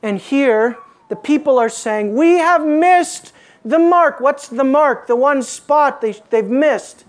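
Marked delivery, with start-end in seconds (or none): 2.1-4.2 loud, high and drawn out; raised, stretched-out declaration; emphatic confession. Acted. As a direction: cry it out slowly on behalf of the people. Preacher voices the people's confession.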